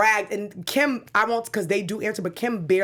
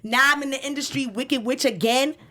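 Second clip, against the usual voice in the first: higher, raised-voice complaint; imitated tweet-rant, pitched up; 4 semitones higher than usual. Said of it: "So this is just me yelling".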